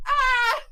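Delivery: high, loud mock shriek; a sudden alarmed yelp; startled cry. Group reacting to scare.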